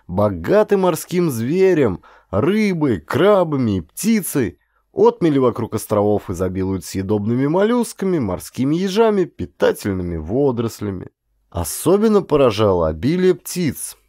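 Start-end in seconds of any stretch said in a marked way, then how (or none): none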